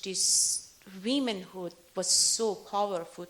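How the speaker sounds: ordinary talk, nothing marked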